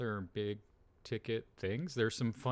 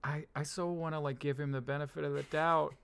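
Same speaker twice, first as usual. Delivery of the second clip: pained whine; 7 semitones higher than usual. Real, not acted. Torn over Columbo's remark.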